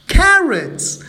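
high, loud exclamation; spring the twist on the listener; surprised reveal. A narrator's reading. Narrator revealing the carrots, loud and surprised.

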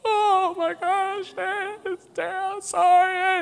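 very high, mock wailing; hammed-up tearful whine, drawn out; sister falling apart. Sensitive sister crumbling at dad's yell, mock high wail. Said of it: "And Vicky would just fall".

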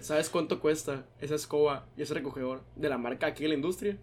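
low, soft, measured reproach; stern lecturing. Retold or imitated. Manager tells barista the broom's price, quiet stern reproach.

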